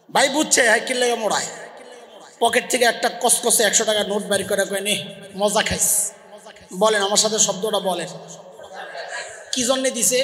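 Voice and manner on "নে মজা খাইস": indulgent father. Retold or imitated. Father hands child a hundred.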